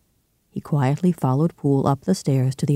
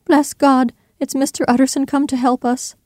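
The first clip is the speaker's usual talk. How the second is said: very high-pitched, overwrought woman's cry; crying cook; 11 semitones higher than usual. A narrator's reading. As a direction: cry out in relief as the distraught cook. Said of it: "clasping her hands and crying".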